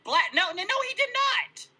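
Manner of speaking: high, insistent protest; heated denial. Real, not acted. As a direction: climb sharply into indignant denial.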